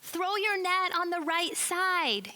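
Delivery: high, loud and slow call across the water. Acted. Stranger on shore calls to the fishermen, loud and slow. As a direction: call the order out over the water. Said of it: "he yells out at them".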